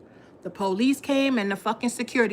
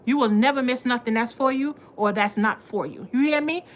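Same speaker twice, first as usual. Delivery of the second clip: usual speaking voice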